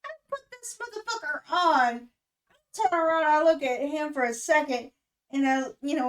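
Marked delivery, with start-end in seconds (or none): none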